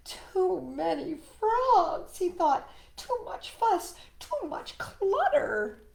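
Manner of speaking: a rabbit at his wits' end; overwhelmed Rabbit. Acted.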